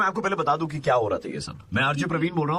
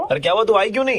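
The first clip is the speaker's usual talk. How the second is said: high, loud mock complaint; put-on scolding; 7 semitones higher, 6 dB louder, faster than usual. Prank caller scolding a stranger for not coming, loudly indignant. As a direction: play an indignant caller kept waiting.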